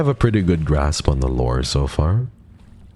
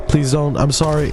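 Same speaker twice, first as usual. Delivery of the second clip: mock pleading; 12 semitones higher than usual. Mock pleading to game enemy.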